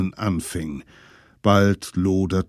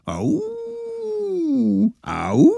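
jubelnd; 3 dB louder than usual. Acted. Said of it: "jubelten die kleinen Brandstifter".

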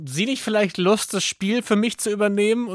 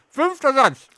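mouth full; 8 semitones higher, 3 dB louder than usual. Real, not acted. Host laughs through full mouth.

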